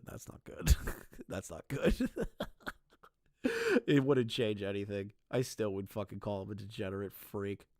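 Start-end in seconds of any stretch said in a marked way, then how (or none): 0.0-2.2 breaking up into laughter; laughing. Real, not acted. Streamer cracking up at his own verdict, laughing. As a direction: laugh helplessly through the words.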